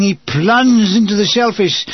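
ordinary delivery

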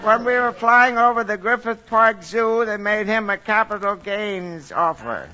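cheery call over a long line; long distance phone call. Acted. Santa phones Mom at North Pole.

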